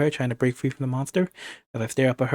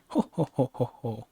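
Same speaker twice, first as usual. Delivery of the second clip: acted French villain chuckle, trailing softer; villain's ho-ho laugh; 4 dB quieter than usual. Villain gloating over rid mademoiselle, acted smug chuckle. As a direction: laugh in spaced ho-hos, fading away.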